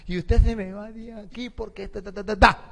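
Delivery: hammed-up indignant dismissal; mock angry tirade. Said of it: "Se puso brava, la insultó".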